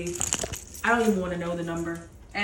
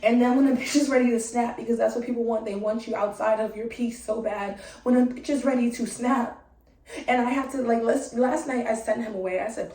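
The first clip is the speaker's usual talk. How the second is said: ordinary talk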